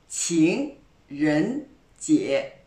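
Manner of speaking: 情人节 said very slowly, each syllable clear, all three second tones rising; slow model pronunciation. Shown as a demonstration. Teacher modelling a word for French learners, slow and careful. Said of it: "On reprend".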